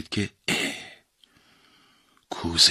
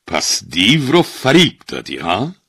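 amused; 5 semitones higher, 7 dB louder than usual. A narrator's reading. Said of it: "خندهٔ کوتاهی کرد و گفت".

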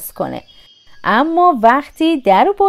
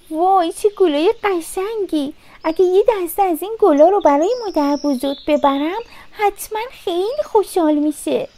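put-on higher-pitched, light girl's voice; delighted Little Red; 6 semitones higher than usual. A narrator's reading. Little Red sees flowers for grandmother, high delighted voice.

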